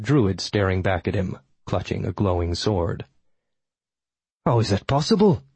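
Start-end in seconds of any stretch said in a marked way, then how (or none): 4.4-5.4 higher-pitched, indignant outburst; villain's voice erupting in shock; erupting in disbelief. A narrator's reading. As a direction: burst out, outraged and incredulous. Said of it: "he erupted".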